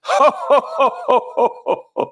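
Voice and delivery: big high booming Santa laugh. Pretend. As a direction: give the kid a jolly ho ho ho. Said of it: "Can you give him a classic ho, ho, ho?".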